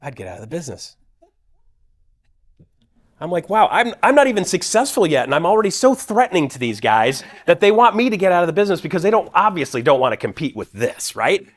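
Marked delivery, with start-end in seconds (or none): 0.0-1.0 flat, quiet deadpan advice. Retold or imitated. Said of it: "looked at me deadpan". 3.5-11.5 excited. Retold or imitated. Naive self-congratulating inner voice.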